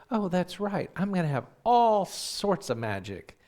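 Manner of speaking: high, excited, swelling voice; delighted realisation. Retold or imitated. Brother remembers Santa will have magic, excitedly delighted.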